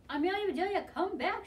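high, loud call after her; shouting. A narrator's reading. Boys to the departing runner, shouting high. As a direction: call back a runner far away. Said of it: "shouted the boys".

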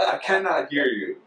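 high-pitched imitation of a Ghanaian villager; Ghanaian villager accent. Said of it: "they'd be like".

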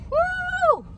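high, overwhelmed woo; emotional woo. Real, not acted. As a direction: let the feeling burst out high. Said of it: "It makes me emotional".

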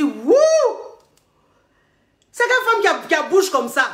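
man stunned by her boldness, high and loud; amazed exclamation. Retold or imitated. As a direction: gasp at her nerve. The man's amazed reaction, quoted.

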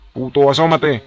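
loud high angry taunt; taunting shout. Real, not acted. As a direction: yell at him to show himself. Taunting Lance to peek.

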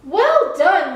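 high, loud congratulation; delighted, emphatic approval; hearty praise. A narrator's reading. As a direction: praise brightly, pitch jumping up. Keeper praises the lighthouse.

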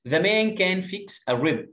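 put-on slow, clear reading for children; slow teacher read-aloud; slow model reading. Shown as a demonstration. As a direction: read it out slowly, word by word.

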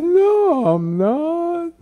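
put-on loud, high-pitched childish denial; hammed-up little-kid refusal; child's protest. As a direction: wail it like an indignant five-year-old. Child denies mother's statement.

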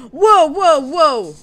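loud startled whoa, rising high. Real, not acted. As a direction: yelp it out in alarm. Sudden danger in fight.

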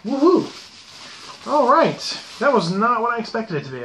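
loud, high whoop of relief; delighted cheer. Real, not acted. Reviewer glad the grab-bag pipe turned out, excited cheer. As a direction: cheer out loud, pitched well up.

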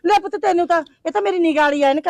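flustered high aunty. Acted. Flustered old woman at home.

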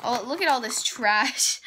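ordinary talk

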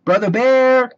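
little sister tired of waiting, calling out; impatient call. A narrator's reading. Little sister impatiently calling her brother at the library. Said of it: "said Sister impatiently".

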